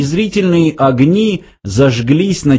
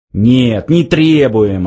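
usual speaking voice

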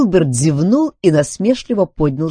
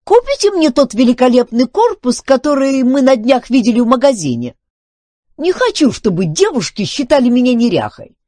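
high, loud, insistent haggling; bargaining robot; 12 semitones higher, 7 dB louder than usual. A narrator's reading. Filbert demands a new body from Duncan, bargaining. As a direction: press the demand, pitched way up. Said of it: "начал торговаться Филберт".

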